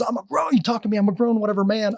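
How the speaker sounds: high-pitched imitation of an indignant man; mock indignant challenge. Angry shopper squares up to storyteller, mock indignant.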